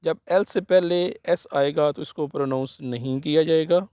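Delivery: ordinary delivery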